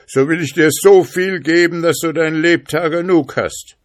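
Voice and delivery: high, loud, eager promise; pleading spirit calling. A narrator's reading. Trapped spirit promises riches for freedom, high urgent plea. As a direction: coax from inside the bottle at full voice. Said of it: "rief der Geist".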